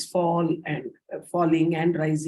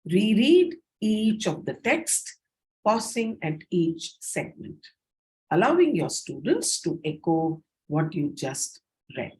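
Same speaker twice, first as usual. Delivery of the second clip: paced by segment. Shown as a demonstration. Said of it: "That's one way of reading".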